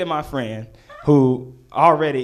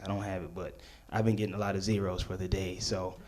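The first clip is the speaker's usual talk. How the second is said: quiet aside; 7 semitones lower, 15 dB quieter, faster than usual. Retold or imitated. Inner thought, aside.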